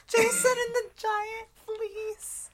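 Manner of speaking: very high, slow, loud parody; drawn-out squeaky mockery; mocking echo. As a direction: repeat the blunder in a teasing falsetto. Mocking the other speaker's slip.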